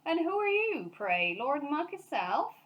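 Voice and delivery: sarcastic gatekeeper; mocking. A narrator's reading. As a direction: sneer at the scruffy bear. Gatekeeper mocks.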